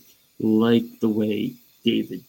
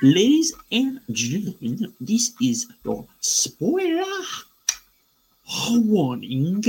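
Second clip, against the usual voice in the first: high, booming announcer voice; airport announcement; 10 semitones higher than usual. Pretend. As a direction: announce it like a PA system. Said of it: "hit them with the alarm".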